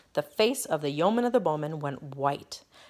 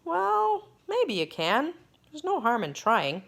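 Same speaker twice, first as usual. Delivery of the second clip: musing king; 9 semitones higher than usual. Acted. King muses aloud.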